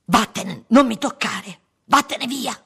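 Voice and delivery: loud, high screamed order; full-throated angry shouting; furious yell. A narrator's reading. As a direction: yell to drive her away. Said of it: "urlò lui".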